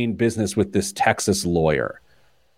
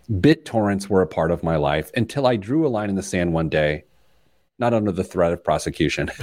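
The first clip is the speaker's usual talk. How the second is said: ordinary talk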